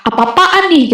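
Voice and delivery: sharp mock-angry outburst, pitch jumping up; angry retort. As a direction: throw it back at her like an annoyed brother. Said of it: "dia sempet marah". (marah pura-pura, nada naik tajam)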